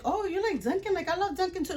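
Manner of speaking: high-pitched imitation of eager fans; mock enthusiastic fan. Voices fellow Dunkin' fans chatting.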